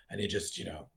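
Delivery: ordinary delivery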